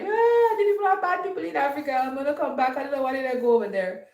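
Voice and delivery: high, fast whiny complaint; hammed-up sob story from a returnee who got robbed; mock whining returnee. Vlogger mocking a robbed returnee, whiny high complaint.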